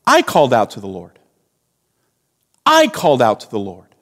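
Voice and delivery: "I" hit hard, the rest dropping low and quick; insistent "I" at the head of each phrase; self-emphasizing stress on I. Acted. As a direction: punch "I", then fall away fast. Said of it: "Jonah is emphasizing what he has done".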